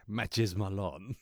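slow, drawn-out mock French accent; Matches Malone. Host introducing himself as an undercover alias, French accent. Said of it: "Where's your French accent?".

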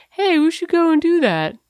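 high, bright mock voice of partner; imitating partner. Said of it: "I don't sound like that".

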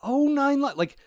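high, loud sudden realisation. Retold or imitated. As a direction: gasp of surprise. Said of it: "I was like".

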